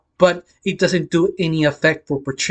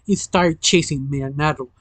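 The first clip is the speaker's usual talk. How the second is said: ordinary delivery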